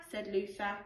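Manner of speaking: put-on lively storybook read-aloud for children; animated picture-book narration with character voices; bedtime story reading. Teacher reads bedtime story aloud.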